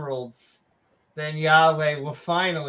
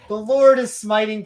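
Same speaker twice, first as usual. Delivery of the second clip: mock biblical prophet; 4 semitones higher, 6 dB louder than usual. Mock scripture reading.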